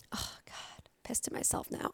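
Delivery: whispered under her breath to herself. Real, not acted. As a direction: hush it, annoyed at yourself. Annoyed at herself for swearing.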